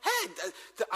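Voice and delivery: ordinary talk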